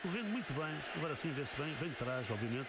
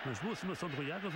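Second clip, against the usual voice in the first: usual speaking voice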